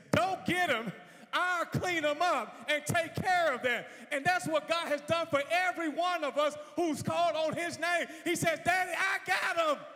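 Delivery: very high, very loud shouted climax; full-force preaching. Real, not acted. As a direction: cry it out as the Son interceding.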